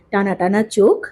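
plain reading voice

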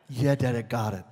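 lightly voiced daughter; little girl voice. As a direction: play the child brushing him off. Child voice in retold scene.

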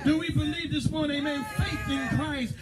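high, fervent preacher's cry; preaching. Acted. Preacher rousing the congregation, fervent preaching. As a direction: preach it out full force to the church.

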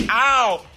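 sudden sharp ow; pained yelp. Real, not acted. Burnt by exploding food.

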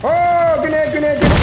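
sudden high shout of warning; grenade warning yell. Real, not acted. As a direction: yell it out fast to warn the team. Calling out a grenade in combat.

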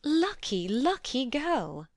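high, drawn-out exclamation; delighted cry. A narrator's reading. Mary reacting to her brother's marriage news, crying out. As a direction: burst out in lifted pitch. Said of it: "cried Mary".

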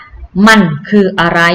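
slow, careful Thai gloss; แปลช้าๆ ชัดๆ; slow translation. Shown as a demonstration. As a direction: spell out the meaning slowly for kids. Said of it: "แปลว่า".

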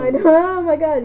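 startled shriek breaking into laughs; scream into giggles. Real, not acted. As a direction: jump scare squeal then giggle. Startled by game character yelling.

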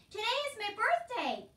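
high, loud excited call. A narrator's reading. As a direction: shout the news after someone far away. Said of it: "Meg called out".

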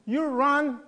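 ordinary delivery

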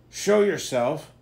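loud, slow, commanding demand; demanding shout. Acted. Creel shouts at the intruder, loud slow demand. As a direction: order the intruder out into the light. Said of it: "Creel demanded".

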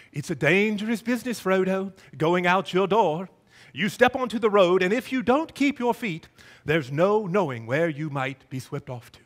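put-on amateur British accent, measured storyteller pace; earnest but homemade British accent; attempted British accent. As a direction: quote it in your best put-on British. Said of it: "That's the best British accent I've got".

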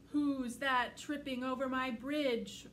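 loud slow booming troll; roaring troll. Acted. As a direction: roar it out like a troll. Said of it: "roared the troll".

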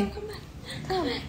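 high, soft pet call; light, high-pitched calling; coaxing a pet. Real, not acted. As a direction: coax the pet back in a small high voice.

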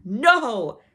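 loud, scornful cry. Acted. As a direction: scorn the guess. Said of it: "rang out scornfully".

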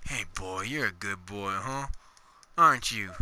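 soft, low, tender dog talk; cooing at a dog. Real, not acted. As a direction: murmur sweet praise to a good dog.

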